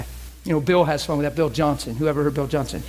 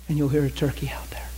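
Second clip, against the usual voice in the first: hushed whisper; 4 semitones lower, 5 dB quieter than usual. Retold or imitated. Voicing God's guidance.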